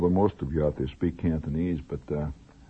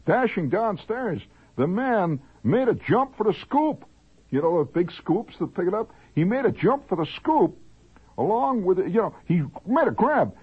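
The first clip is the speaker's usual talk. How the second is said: high, swooping singsong narration; melodramatic news read; 13 semitones higher than usual. Acted. Host telling of man's jump for the scoop, high melodramatic narration. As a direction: declaim each twist as breathless drama. Reads a news note theatrically.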